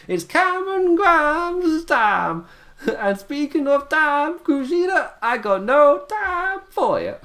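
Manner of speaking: high, loud, drawn-out Southern twang; cartoon Southern drawl. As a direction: stretch every word in a hammed-up Southern accent. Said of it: "he said it just like that".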